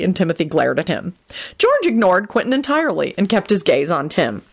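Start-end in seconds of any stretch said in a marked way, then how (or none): none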